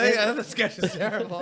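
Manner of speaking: high, laughing mock voice; lightly hammed-up viewer, laughing at the sketch; mock agreement. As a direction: laugh along at the flop. Mocking a flopping host.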